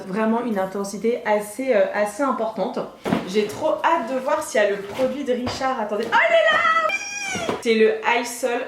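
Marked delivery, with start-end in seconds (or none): 6.1-7.6 cri aigu et ravi, en riant; éclate de joie en le découvrant; excited shout. Real, not acted. Trouve le produit de Richard. (cri aigu de joie en riant)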